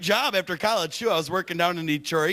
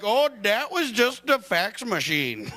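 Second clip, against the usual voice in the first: mock Indian accent; 4 semitones higher, slower than usual. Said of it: "They're like".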